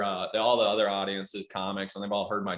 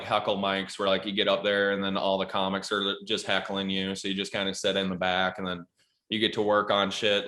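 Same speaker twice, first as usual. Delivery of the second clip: ordinary talk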